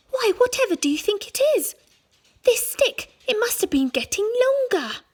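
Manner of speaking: put-on high, fast fussy old wife; Mrs Twit. Wife fretting to her husband, high and fussy. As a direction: squeak it out as a fretful old woman.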